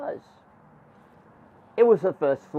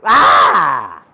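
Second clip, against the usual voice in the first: loud drawn-out "ah" scream; scared scream; 2 semitones lower, 12 dB louder than usual. Acted. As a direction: let out a loud storyteller's scream. Scared by the warning.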